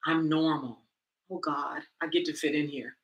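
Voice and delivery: soft, hushed relief; quiet relief. Retold or imitated. Newlywed voiced with hushed relief. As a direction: sigh it out with relief.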